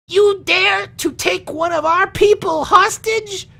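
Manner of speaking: very high, slow, outraged squeak of a voice; indignant halfling man. Acted. Halfling man to the orcs demanding their captive back, in a high outraged voice. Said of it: "the middle-aged man speaks up and goes".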